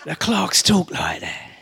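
slow, put-on London clerk accent; clerks' accent. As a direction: imitate how the clerks talk, drawn-out accent. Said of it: "they all talk like this".

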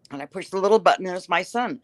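ordinary talk

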